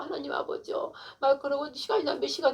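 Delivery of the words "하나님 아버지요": breathy whisper to God; whispered prayer. Retold or imitated. Alone after a night of prayer, whispering to God. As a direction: hush it like a private prayer.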